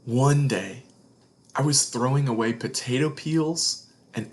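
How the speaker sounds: gentle storybook read-aloud; expressive picture-book narration; reading to children. A narrator's reading. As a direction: tell it like a bedtime story to kids.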